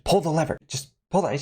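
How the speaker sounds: high, insistent mock command; urging her. Hangman performer tells the showgirl to act, high urging. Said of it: "he's telling Carly to".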